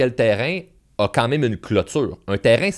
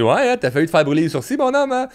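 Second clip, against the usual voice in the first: teasing father; 7 semitones higher, 3 dB louder, faster than usual. Retold or imitated. Father teasing son, laughing.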